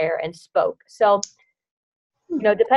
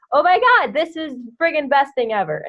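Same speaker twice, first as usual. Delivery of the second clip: excited; 6 semitones higher than usual. Retold or imitated. Imagined thrilled reaction at event.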